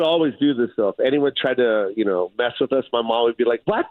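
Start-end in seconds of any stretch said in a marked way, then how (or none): 3.7-3.9 mom voice. Retold or imitated. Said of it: "the Joe Coy mom voice".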